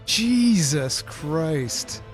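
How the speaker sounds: very slow, drawn-out oath; exasperated disbelief. Real, not acted. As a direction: drag out each word in dismay. Reacts to insulting age guess.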